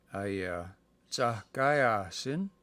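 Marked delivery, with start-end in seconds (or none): 1.1-2.5 Tahkaya Sin said slowly, rising at the end; slow word demo. Shown as a demonstration. Teaching a student the diminutive, said slowly. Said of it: "is like the, the diminutive".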